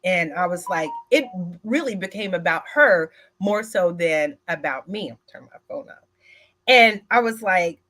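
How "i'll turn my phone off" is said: a quick hushed whisper off to the side; whispered aside. Real, not acted. Telling viewers aside she'll silence her phone, whispered.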